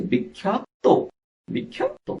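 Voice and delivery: বিখ্যাত ending on a clear, separated "to"; final-o pronunciation. Shown as a demonstration. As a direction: sound out "bik-khyat-to" for বিখ্যাত, with an open o ending. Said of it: "ততে ওকার হয়েছে".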